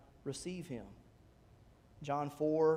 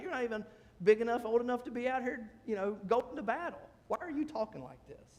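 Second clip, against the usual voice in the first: higher-pitched scornful put-down; belittling; 6 semitones higher, faster than usual. Acted. Older brother tells David he's too young for battle, belittling. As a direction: talk down to someone too young. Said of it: "he belittles him".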